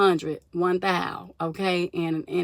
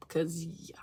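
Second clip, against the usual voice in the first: whisper; 2 semitones lower, 11 dB quieter than usual. Real, not acted. Trailing aside.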